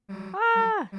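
high, loud cry; sudden acted yelp. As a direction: yelp out sharply in character. Game character cries out mid-scene.

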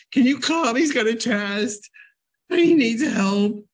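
loud, high pleading call for help; urgent plea. Retold or imitated. Parent begging for tutoring help, loud urgent plea.

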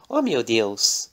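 slow, drawn-out gasp; a touch of mock wonder; lightly voiced maid drawing out her surprise. Daniela finds the brooch.

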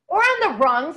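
high, loud outburst; burst out. A narrator's reading. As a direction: blurt it out loudly. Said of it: "I burst out".

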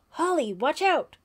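high, loud shout of alarm; a camper shouting a warning across the field. Acted. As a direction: yell to warn her of the ball. Warning of incoming softball.

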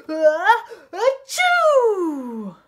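huge acted sneeze, high and loud; explosive sneeze. Flea wakes the whole barn, explosive mock sneeze. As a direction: gasp in, then blast out a long achoo. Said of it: "before he could cover his snout".

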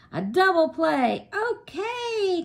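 loud, high excited shout; excited cry. A narrator's reading. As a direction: cheer the play like a thrilled coach. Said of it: "cried Coach Wilson".

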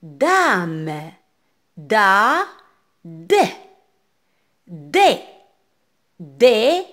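loud, isolated syllables with pauses; projected phonics drill voice; read-along drill. Shown as a demonstration. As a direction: sound out each piece firmly for pupils to echo. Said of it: "lisez avec moi".

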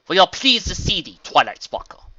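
booming regal greeting; needlessly loud. A narrator's reading. Princess Luna greets Twilight, booming. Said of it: "several times louder than it needed to be".

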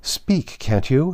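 high-pitched impatient demand. A narrator's reading. Heya prods the silent Hadda to answer, impatient.

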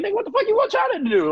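high, exasperated retort; exasperated disbelief; mock exasperated shout; incredulous outburst. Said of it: "I'm like".

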